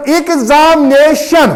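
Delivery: Examination slowly broken into its syllables; examination as ig-zam-nay-shan, each part held. Shown as a demonstration. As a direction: sound out examination beat by beat. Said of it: "कितने में हमने इसको किया है".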